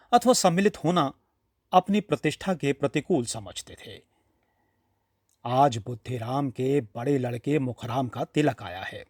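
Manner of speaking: ordinary delivery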